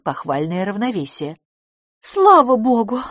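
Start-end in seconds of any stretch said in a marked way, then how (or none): 2.0-3.0 loud, high burst of relief; exhaled relief. A narrator's reading. Miss LaFosse sees her friend can walk, relieved. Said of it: "выдохнула мисс Лафос".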